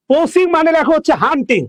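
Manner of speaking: normal talking voice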